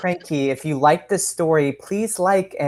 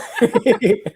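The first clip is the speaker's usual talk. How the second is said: a short, high, amused laugh; real laugh; 6 semitones higher than usual. Real, not acted. Host laughs at her own joke, light high laugh.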